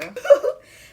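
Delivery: high burst of laughter. Real, not acted. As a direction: squeal a laugh. Laughs after joke.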